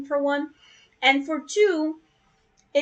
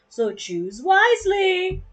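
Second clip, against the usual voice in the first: dramatic warning; 8 semitones higher, 4 dB louder, slower than usual. Pretend. Dramatic end to tutorial read.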